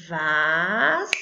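Tesoura said slowly, mouth opening on each syllable; syllables counted aloud. Shown as a demonstration. Teacher showing kids how to count syllables, slow. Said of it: "Abri a boca pra falar quantas vezes?".

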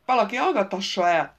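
loud, exasperated outburst; snapping. A narrator's reading. Royce snapping at the group, loud and fed up. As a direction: snap at everyone to silence him. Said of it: "csattant fel Royce is".

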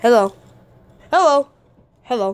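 normal talking voice

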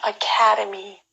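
Academy said with ca stressed. Shown as a demonstration. Teacher models Academy for student repeat. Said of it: "캐의 강세가 있고요".

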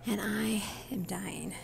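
slow soft whisper. Real, not acted. Mom whispering in the empty weight room, slow whisper. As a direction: hush it to the camera. Quiet gym.

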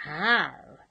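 loud gruff pirate captain growl; captain's hammed-up pirate growl. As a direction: growl it to send him off. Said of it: "growled Captain Wibbly Shins".

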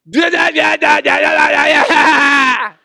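high, loud slow tantrum babble; childish da-da. Retold or imitated. Pastor mimics his own childish tantrum at daughters, loud babble. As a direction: shout nonsense like a sulking kid. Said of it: "such a childish response".

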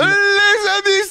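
slow, high, loud host greeting; drawn-out hype call. Real, not acted. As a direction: stretch it out like a show host. Opening the video outro.

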